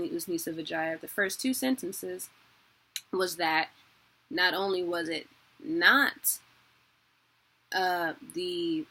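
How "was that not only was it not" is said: usual speaking voice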